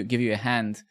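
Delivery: usual speaking voice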